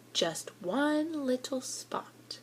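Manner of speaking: whispered, slow; hushed. A narrator's reading. Boy telling of the tiny feeding, whispered. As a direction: breathe it out, tiny amount. Narrator stressing tiny amount.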